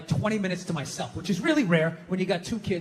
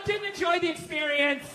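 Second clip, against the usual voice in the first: higher-pitched fault-finding; mock bad review; 7 semitones higher than usual. Wife reviewing her husband, mock complaint. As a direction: read it like a complaining customer.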